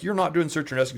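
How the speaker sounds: high, insistent contradiction; mock accusation. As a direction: imitate someone calling out an impostor. Retold accusation from choir member.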